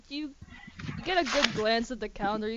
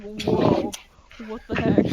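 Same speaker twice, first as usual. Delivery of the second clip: louder startled exclamation; surprised Silas; 10 dB louder, slower than usual. Retold or imitated. Silas watches the complex handshake, louder startled voice. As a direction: react like you just saw something odd. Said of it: "you're like".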